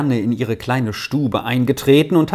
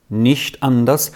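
plain reading voice

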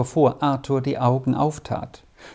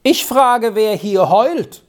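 high, loud demanding bellow; bully yelling across the dark dormitory; furious, drawn-out demand; louder grim shout; 7 semitones higher, 4 dB louder than usual. A narrator's reading. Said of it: "Noch einmal und lauter rief er in seinem Grimm".

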